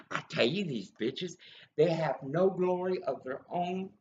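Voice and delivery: usual speaking voice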